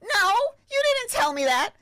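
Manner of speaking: shocked cry at a client's news; stunned. Retold or imitated. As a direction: disbelief bursting out. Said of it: "I'm like".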